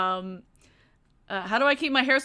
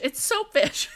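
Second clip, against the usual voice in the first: higher-pitched, giggly delivery; laughing; 6 semitones higher than usual. Real, not acted. Streamer repeats a silly name for lutefisk, laughing. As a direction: crack up while saying it. Cracks up at own phrase.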